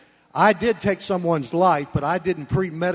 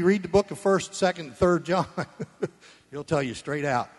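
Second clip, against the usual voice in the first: normal talking voice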